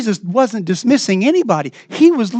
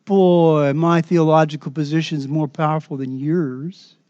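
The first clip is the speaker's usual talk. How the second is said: condescending; 5 semitones lower, 4 dB quieter than usual. Pretend. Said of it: "we get all condescending".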